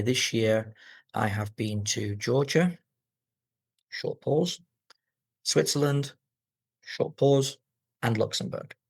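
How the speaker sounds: short pause after "Georgia" and "Switzerland"; short pauses in list. Shown as a demonstration. Teacher showing students comma pauses, list with short pauses. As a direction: set "Georgia", "Switzerland" and "Luxembourg" apart with brief pauses. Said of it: "you should take a short pause".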